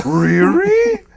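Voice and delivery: hammed-up squeal like an enthusiastic Scooby-Doo; enthusiastic Scooby-Doo Really. Imitating the interviewer for the band, with a Scooby-Doo squeal. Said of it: "So she'd go".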